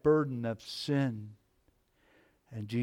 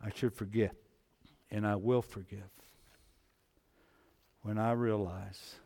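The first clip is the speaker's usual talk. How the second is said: ordinary talk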